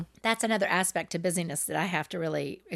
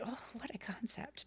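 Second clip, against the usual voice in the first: breathy whisper, almost no voice; whispered aside; 18 dB quieter than usual. Retold or imitated. Mom whispering her reaction to her son's advice. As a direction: whisper it to yourself in wry disbelief. Retelling her reply to son.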